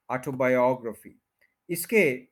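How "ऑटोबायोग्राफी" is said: Autobiography as aw-to-bai-AWG-ruh-fee; fourth-syllable stress. Shown as a demonstration. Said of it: "fourth के ऊपर stress है".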